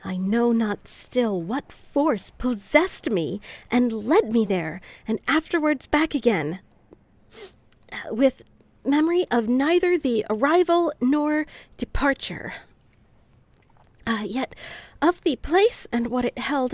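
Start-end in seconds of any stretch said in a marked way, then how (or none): 0.0-12.5 grand storybook narration; reading aloud. Acted. Reads old book aloud. 15.0-16.7 reading aloud. Acted. Reads old book aloud.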